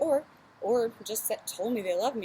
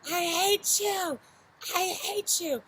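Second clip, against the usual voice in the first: higher, slow, drawn-out yell; retold scream; 8 semitones higher, 3 dB louder, slower than usual. Teen screaming at her dad through the wall, higher and slow. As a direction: relive the teenage outburst. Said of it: "I was screaming".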